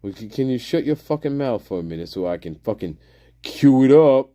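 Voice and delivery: ordinary talk, nothing marked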